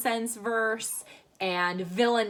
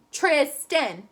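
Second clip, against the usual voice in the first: Tristan in two separate beats, higher; over-enunciate Tristan, TRIS, TAN; exaggerated syllables; 4 semitones higher, 4 dB louder than usual. Shown as a demonstration. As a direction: open wide on each half of Tristan. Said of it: "do it this way again".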